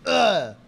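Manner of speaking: loud, high grunt of effort; angry grunt. Acted. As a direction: grunt as if thrusting a bag at someone. Script: thrusting the bag.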